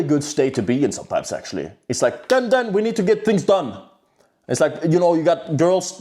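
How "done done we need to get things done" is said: high-pitched, driven demand; pissed-off urgency. Acted. Man voicing his fired-up angry state, urgent and pissed off. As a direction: fire it off like an impatient order. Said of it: "hatred and being pissed off".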